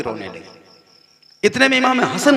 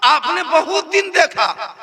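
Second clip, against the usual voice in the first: wailing plea; 10 semitones higher, 4 dB louder than usual. Acted. Husain asking his family why they stare, high wailing lament. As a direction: plead as a child sensing something wrong. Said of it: "इमाम हुसैन फरमाते".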